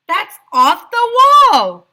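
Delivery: loud, high outraged yell; teacher's outraged yell. A narrator's reading. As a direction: shout it in protest. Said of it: "yelled Miss Small".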